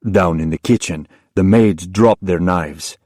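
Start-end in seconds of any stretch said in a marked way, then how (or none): none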